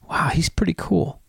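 hushed, impressed whisper; mock-awed whisper to oneself; whispered inner thought. Listeners' private thought, acted.

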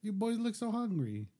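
high, slow doting mother voice; mom voice. Retold or imitated. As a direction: coo like a fussing Italian mom.

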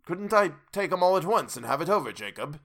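high, hurried, tentative suggestion; nervous wheedling request; hinting. A narrator's reading. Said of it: "hinted Scrooge".

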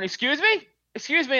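high-pitched, questioning "excuse me"; imitated woman calling someone out; indignant passer-by. Voicing an offended woman passer-by.

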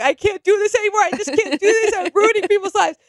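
hammed-up tearful breakdown; imitating crying boxer. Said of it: "And Deontay cries".

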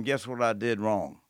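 ordinary talk, nothing marked

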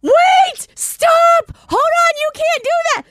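very high, mock-frantic shriek; retold panicked scream. Nursing mom as the milk lets down, acted high scream. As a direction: re-enact screaming to make it stop. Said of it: "And I'm screaming".

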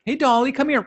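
high, loud pretend call to a dog; mock dog call. Owner calling Dolly over, playful high call.

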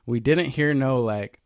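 ordinary delivery

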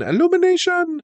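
Illumination! flung up very high; a high gleeful shout of Illumination!; excited shout. Acted. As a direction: cry out Illumination! in a big high rise. Excited repeat of studio name.

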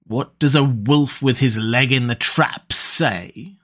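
ordinary delivery

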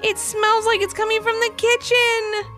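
put-on high, urgent kid exclamation; excited girl voice. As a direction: point it out like an alarmed child. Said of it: "exclaimed Ivy".

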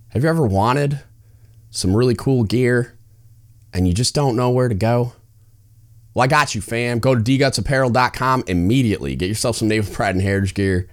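upbeat, high-pitched promo pitch; ad read. Acted. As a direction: sell it like a hype commercial. Hyped podcast ad read.

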